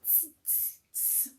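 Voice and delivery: three sharp voiceless "tss" sounds; short, evenly spaced monkey hisses; monkey noise. Acted. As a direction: tease like a monkey with soft hissed bursts. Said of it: "channel your inner monkey".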